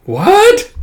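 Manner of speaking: high, loud squeak of shock. Acted. Akane stunned by the master's rule, squeaking in shock. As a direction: jolt up in disbelief.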